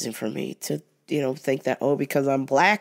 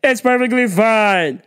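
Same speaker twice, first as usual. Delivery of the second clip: loud, demanding customer call; imitating the pushy shopper demanding service, loud; entitled customer; 3 semitones higher, 6 dB louder than usual. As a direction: snap for attention like you're owed it. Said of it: "lower your voice a little bit".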